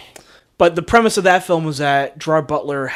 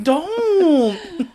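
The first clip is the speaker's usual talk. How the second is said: high, drawn-out playful plea; mock plea; 14 semitones higher than usual. Friend jokingly threatens to hurt Jackie Chan, mock plea. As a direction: beg in mock distress, stretching the word.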